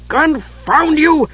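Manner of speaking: slow, high-pitched exasperated growl; Flint growling. A narrator's reading. Dwarf cuts off the prattling Kender, growling. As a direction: growl it out, stretching the words. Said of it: "Flint growled".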